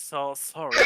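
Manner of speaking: ordinary talk, nothing marked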